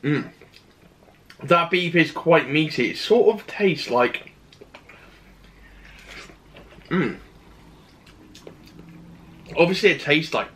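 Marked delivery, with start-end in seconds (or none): 0.0-7.2 speaking between bites; muffled chewing speech; chewing with mouth full. Real, not acted. Seen 1: Bite taken at -3s; closed, working mouth through the labelled words.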